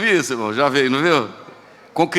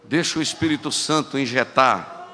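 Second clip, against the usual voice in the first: usual speaking voice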